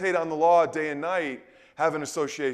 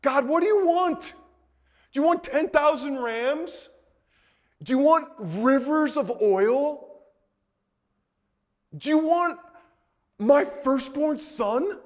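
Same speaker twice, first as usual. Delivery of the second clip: a higher, earnest plea, lightly voiced; Micah pleading with God; 5 semitones higher than usual. Micah asks God what he wants, a higher pleading voice. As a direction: ask God high and earnest, one offer after another.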